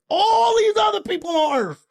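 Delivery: exasperated shout. Acted. Man protesting to an alien about other people on Earth, exasperated shout.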